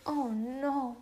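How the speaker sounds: mildly stricken, imitating a worried mother; devastated mom. Mom reacting to daughter's lice news, mild devastation. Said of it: "she's so devastated".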